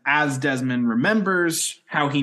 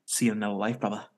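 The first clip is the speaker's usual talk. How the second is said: Desmond quote; 4 semitones lower, 5 dB quieter, much faster than usual. Retold or imitated. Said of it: "once again says".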